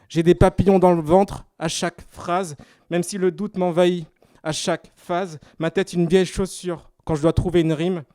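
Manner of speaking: measured, projected lines with pauses between phrases; slam poetry recitation. Acted. Slam poet thanking companions for the adventure, rhythmic declaiming.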